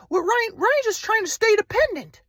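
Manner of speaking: high, airy-calm imitated grandpa; spiritual grandpa. Grandpa from Kauai lecturing Ryan, high serene mock voice. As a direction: preach oneness like a serene guru.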